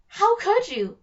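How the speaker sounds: loud, high wounded outcry; hammed-up betrayal, whining at his sister; betrayed. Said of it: "being completely betrayed".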